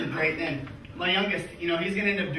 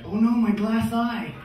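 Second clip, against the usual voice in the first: higher, drawn-out cry of alarm; mock dismay; 5 semitones higher, 3 dB louder, slower than usual. Man faking a lost glass eye in the toilet, mock dismay. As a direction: wail it in pretend dismay. Acting out a fake mishap.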